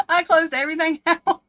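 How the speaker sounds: loud and high, laughing through it; giggly, loud recounting. Real, not acted. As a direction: laugh at your own fright. Laughing at own fright.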